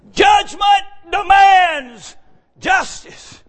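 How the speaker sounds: high, loud fired-up sermon cadence; preaching at full force. Acted. Preacher calling to the congregation, loud and fervent.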